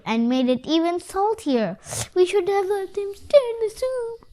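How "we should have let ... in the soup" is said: high, tearful voice; sniffling. A narrator's reading. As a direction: sniff through the words. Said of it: "sniffed the squirrel".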